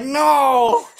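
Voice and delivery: high squeaky shouted no; laughing gnome shout. Acted. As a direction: shriek it while laughing.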